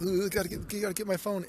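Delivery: high and loud, hurried; excited. Retold or imitated. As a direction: scramble for the phone in a rush. Retold hurried reaction.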